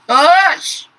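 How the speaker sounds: sudden high, loud HUSH; exaggerated shouted hush. Mock hushing, then repeated in usual voice.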